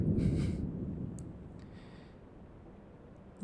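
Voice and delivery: hushed amused chuckle; soft laugh. Acted. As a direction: giggle quietly, conspiratorial. Laughs about professor being aware.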